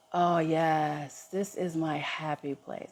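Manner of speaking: quiet and slow, lightly voiced little girl; a hush of relief; soft, contented child. Inner child in the woods, softly content.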